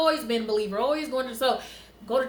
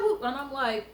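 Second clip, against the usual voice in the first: usual speaking voice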